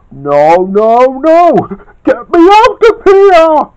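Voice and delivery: panicked yell. Acted. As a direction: scream to be let out. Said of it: "yelled Kid".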